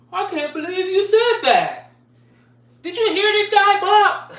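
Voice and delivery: high, loud, scandalized gasp of a voice; mock the touchy crowd with a high shocked whine; mock shocked soft guy. Mocking oversensitive people online, high shocked voice. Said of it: "That's how they talk".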